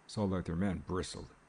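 plain reading voice

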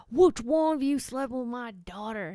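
lightly acted angry accusation; angry farmer. Farmer with a shotgun confronts two guys, angry.

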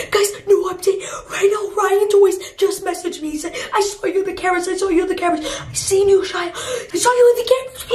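high-pitched, breathless update; panicked alarm. Acted. Vlogger reading viewers the owner's message, panicked alarm. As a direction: read it out in rising alarm.

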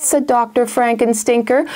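ordinary delivery

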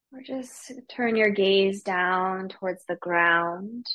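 guided meditation. Real, not acted. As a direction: ease them gently into stillness, unhurried. Leads the class into meditation.

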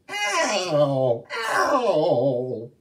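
high, loud yelping ow; a hammed-up yelp of hurt; cry of pain. Younger sister hit on the foot, loud yelping cry. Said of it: "she cried".

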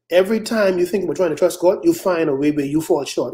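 normal talking voice

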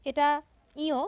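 ordinary delivery

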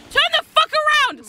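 loud, high yell at the character; frustrated yell. Real, not acted. Yelling at Luigi on screen, loud and exasperated. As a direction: snap at him to turn around.

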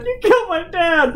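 slow, high mock wail; fake crying. Kid swinging at the tire, fake crying. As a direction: drag it out in a hammed-up sob. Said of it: "like, fake crying".

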